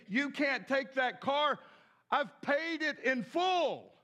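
high, slow emphatic outcry; loud protest. Retold or imitated. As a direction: call it out slowly, stretching the final words. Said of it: "you jump out in a loud voice".

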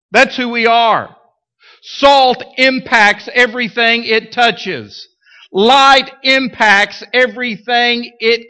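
rising, emphatic pulpit preaching. Acted. Preaching builds intensity.

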